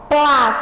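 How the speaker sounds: "Plás" mais alto, com "s" esticado; sílaba tônica destacada. Shown as a demonstration. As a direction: solte "Plás" forte e isolado. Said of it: "Olha aqui como eu falei". ("Plás" com força, som final alongado)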